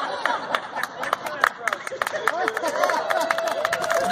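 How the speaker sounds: long airy giggle breaking up the speech; real laugh, high and unvoiced; breathy high laugh. Real, not acted. Drag queen cracking up at her own church joke, laughing.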